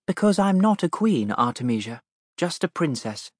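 put-on a kind princess stooping to a child; cartoon princess. Princess comforts crying girl.

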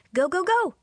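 high, frantic urging; urgent shouting. A narrator's reading. Faye locked in the car urges driver on, shouting urgently. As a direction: push the driver to move, pitch way up.

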